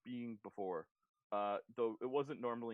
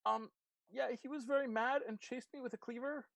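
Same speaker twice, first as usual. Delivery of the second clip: high, nervous little voice; Blackberry, flustered; 11 semitones higher than usual. Acted. Blackberry tells of the mad chef, flustered and high. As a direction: report it anxiously, pitched well up.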